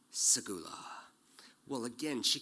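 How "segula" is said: low, soft, unvoiced whisper; whispered segula. Man to his date at her door, tender whisper. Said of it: "I say, Pam".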